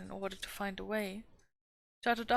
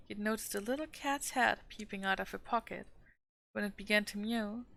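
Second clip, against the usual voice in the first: plain reading voice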